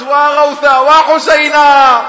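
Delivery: high, loud, slow wailing cry; a grieving woman crying out for Husayn, wailing loud and high; lamenting wail. Retold or imitated. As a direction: wail it out as if tearing at your clothes. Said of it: "صرخت".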